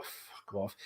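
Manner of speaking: breathless unvoiced mutter under the breath; a whispered aside of contempt; muttered curse. Real, not acted. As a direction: spit it out almost silently, disgusted. Muttered reaction to quoted statement.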